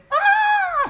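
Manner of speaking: very high piercing scream; shrill scream. A narrator's reading. Mother fainting at the news, a piercing scream. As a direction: shriek in shock. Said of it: "un cri strident".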